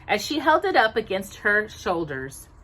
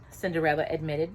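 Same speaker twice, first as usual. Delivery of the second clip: ordinary delivery, nothing marked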